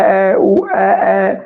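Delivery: lightly imitating a small child's first sounds; a hint of baby babbling; baby babble. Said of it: "ਬੱਚਾ ਛੋਟਾ ਹੁੰਦਾ ਹੈ, ਬੋਲਦਾ ਹੈ".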